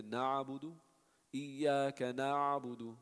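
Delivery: touch the ayn in na'budu lightly; slow recitation, gentle ayn; lighter ayn. Shown as a demonstration. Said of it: "pronounce it a little bit lighter".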